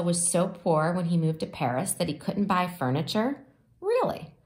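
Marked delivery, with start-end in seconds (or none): 3.8-4.3 high rising really; amazed high question; surprised. Real, not acted. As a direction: gasp of amazement. Listener reacting to a fact.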